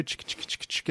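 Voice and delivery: whispered clicking bee sounds; bee impression. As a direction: click out a little bee noise. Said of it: "Can you do your bee impression again".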